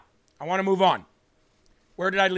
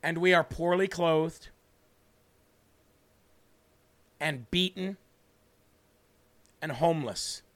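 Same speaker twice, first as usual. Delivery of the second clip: solemn, slow verse with long pauses; slow reading. Shown as a demonstration. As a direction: read it phrase by phrase, pausing long after each. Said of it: "I'll read it slow".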